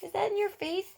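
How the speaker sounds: mother talking to her infant, high and sing-song. Real, not acted. Mom cooing to her baby.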